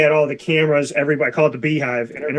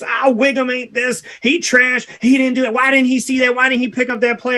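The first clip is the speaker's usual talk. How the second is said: much higher, mock armchair-critic voice; mocking fan critic; 9 semitones higher than usual. Host mimics fans trashing the player, high mock critic voice. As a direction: parody a fan griping at the TV, pitched way up.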